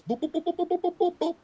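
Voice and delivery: high string of quick boops; rapid-fire cartoonish booping; imitated rapid boops. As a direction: fire off mock impact noises, high and quick. Imitating rapid sequence of moves.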